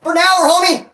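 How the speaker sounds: shouted coach line. Retold or imitated. Quoting the coach to the camera, a high loud yell.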